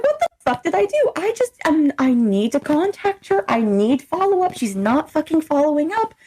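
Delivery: high-pitched indignant protest; retold exasperation, voice riding high; exasperated self-defence. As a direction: plead your case in disbelief. Retelling her baffled self-defence.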